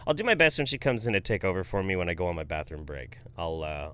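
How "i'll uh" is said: normal talking voice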